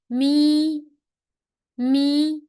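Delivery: "Mi" held high and level; first tone mi. Shown as a demonstration. Teacher demonstrating the first tone on mi. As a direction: keep "Mi" flat and high. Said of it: "and the first tone".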